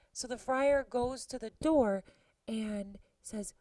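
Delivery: normal talking voice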